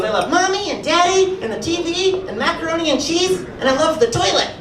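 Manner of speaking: put-on high, sing-song child's voice; little nephew. Nephew happily listing what he loves, in a high child's voice. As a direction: chirp it like an innocent little kid. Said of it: "And he goes".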